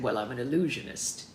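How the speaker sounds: low showman's stage voice; Alex Storm. A narrator's reading. Performer introducing himself to partner's parents, stage-persona voice. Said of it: "In the most Alex Storm voice".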